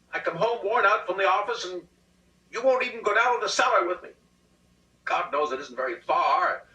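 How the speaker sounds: mais agudo e mais alto, reclamando; lamuria de marido. A narrator's reading. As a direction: um marido choramingando contra a esposa. Husband whining complaint.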